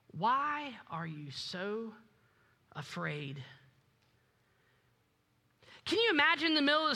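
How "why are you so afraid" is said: low, soft and slow, unhurried; calm soft question. Acted. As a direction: ask it gently, no alarm at all. Jesus answers calmly in storm.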